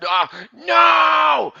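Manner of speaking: high, loud drawn-out no; victim's scream. Acted. Victim about to be eaten, high screamed no. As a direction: scream it in mock terror.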